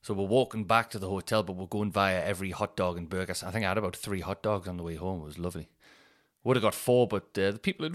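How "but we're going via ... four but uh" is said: ordinary delivery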